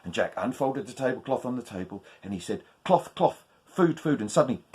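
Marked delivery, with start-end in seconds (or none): 2.8-4.2 higher, chanted spell; magic words. Acted. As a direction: call out the spell eagerly. Jack says the magic words.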